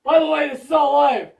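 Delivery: loud aside shout. Real, not acted. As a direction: call it out loud.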